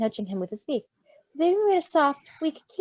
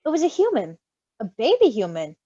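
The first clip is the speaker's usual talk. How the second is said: ordinary delivery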